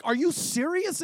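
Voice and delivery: mock outrage, voicing the high priest in a sermon; outraged high priest. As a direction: toss it off like an offended elder. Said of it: "like, in anger almost".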